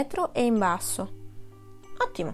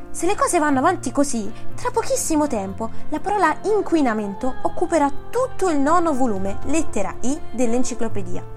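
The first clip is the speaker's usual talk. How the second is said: louder, higher indignant complaint; an encyclopedist's outraged lament; huffy scholar scolding the world; 5 semitones higher, 7 dB louder than usual. A narrator's reading. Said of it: "aggiunse indignato".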